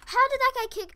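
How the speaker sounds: loud, high cry of disbelief; outraged shout. Real, not acted. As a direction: shout it in protest at losing. Outraged at losing the fight.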